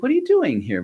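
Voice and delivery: high, delighted surprise; surprised greeting. Retold or imitated. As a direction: greet him in amazement. Surprised to see old leader.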